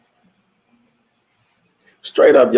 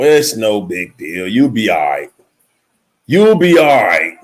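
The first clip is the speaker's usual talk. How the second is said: mock dismissive; 10 semitones lower than usual. Mocking dismissive partner reply.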